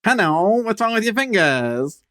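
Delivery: a slow, high, light attempt at a character voice; attempted impression. Trying a celebrity voice on the knife joke, slow and high. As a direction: drag it out high as a half-hearted impression. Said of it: "That was not what he does".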